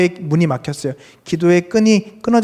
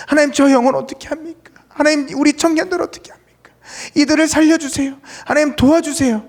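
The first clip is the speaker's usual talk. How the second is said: high, urgent pleading with God; crying out in prayer; 10 semitones higher than usual. Acted. Praying for lost souls and young people, crying out. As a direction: beg God to save them, voice raised high. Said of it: "그를 위해서 부르짖게 하시고요".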